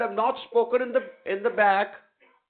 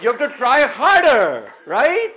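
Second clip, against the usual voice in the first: energetic coaching; 6 semitones higher, 10 dB louder than usual. Real, not acted. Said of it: "teacher coaching loudly, energized".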